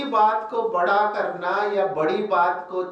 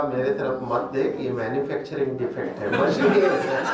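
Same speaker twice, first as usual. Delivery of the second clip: lower, quick, amused divine voice; God telling the speaker it is a manufacturing defect, low and quick; Shiv Baba; 8 semitones lower than usual. Retold or imitated. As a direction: answer drily from above, hurried. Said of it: "बाबा ने मुझे कहा".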